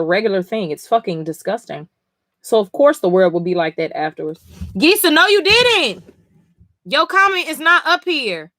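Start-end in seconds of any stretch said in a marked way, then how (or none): 4.7-8.5 high, loud, incredulous "no, you didn't"; surprised protest. Real, not acted. Streamer finds Gisa's comment missing, high surprised protest. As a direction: sound caught out and indignant.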